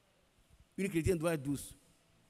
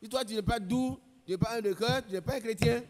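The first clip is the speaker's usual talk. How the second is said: higher, louder fervent preaching; 5 semitones higher, 6 dB louder than usual. Acted. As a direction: press it on the congregation. Preaching at full force.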